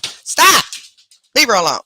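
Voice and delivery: shouted high, loud stop; yelling at pet. Real, not acted. At the pet bothering her cat, a loud high shout. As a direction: yell to break up the pets. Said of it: "Sorry, I mean yelling out here".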